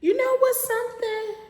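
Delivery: squeaky, high put-on voice; high-pitched voice. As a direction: jump way up into a squeaky voice.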